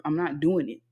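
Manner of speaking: ordinary talk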